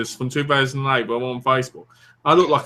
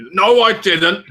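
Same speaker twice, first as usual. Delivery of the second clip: louder mock-indignant denial; insistent denial; 3 semitones higher, 5 dB louder, slower than usual. Retold cousin denying it twice, insistent denial. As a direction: deny it with a touch of outrage.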